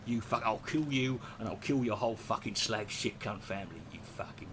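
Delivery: low, menacing London gangster growl, fading to a breath; Ray Winstone impression. Imitating Ray Winstone threatening someone, low menacing Cockney. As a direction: threaten like a hard Cockney villain, low and dark. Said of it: "He's like".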